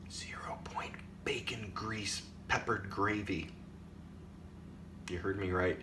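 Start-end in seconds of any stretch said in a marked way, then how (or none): 0.1-3.5 unvoiced conspiratorial whisper. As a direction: whisper it like a secret. Secret-reveal whisper.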